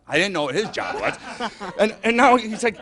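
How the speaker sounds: ordinary talk, nothing marked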